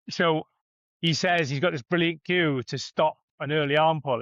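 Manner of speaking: ordinary talk, nothing marked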